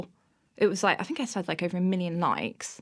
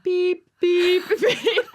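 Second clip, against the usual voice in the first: mock reversing beep; 10 semitones higher, 7 dB louder, much slower than usual. Imitating reversing caravan beep.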